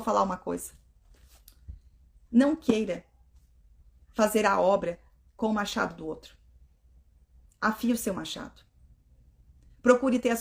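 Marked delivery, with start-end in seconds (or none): none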